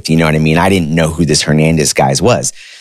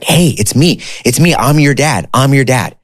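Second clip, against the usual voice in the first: an overjoyed dad finally reaching his son; thrilled; 7 semitones higher than usual. Retold or imitated. Long-lost father on the phone to his son, thrilled and higher.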